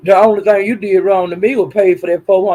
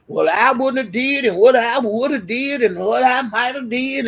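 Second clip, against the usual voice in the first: mocking gossips; 4 semitones higher than usual. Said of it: "everybody talking about".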